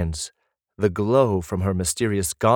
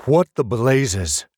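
louder, slow shocked outburst; sharp exclamation; 5 semitones higher, 5 dB louder, slower than usual. A narrator's reading. Brother sees the prisoner's scarred back, slow shocked outcry. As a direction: let it cut across the cave. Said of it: "his voice slicing along the cave walls".

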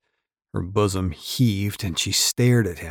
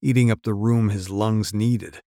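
plain reading voice

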